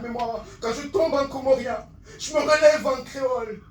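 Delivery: high, forceful verse; impassioned slam declamation. Acted. Poet proclaims his two languages, impassioned slam. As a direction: push the line out proudly. Climax line of poem.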